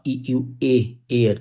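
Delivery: ordinary talk, nothing marked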